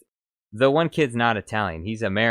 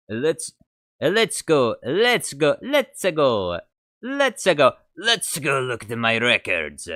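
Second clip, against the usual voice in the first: put-on higher, louder Italian accent practice; 6 semitones higher, 7 dB louder, slower than usual. Streamer practising Watto's line in an Italian accent. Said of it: "you gotta try and imitate an Italian accent".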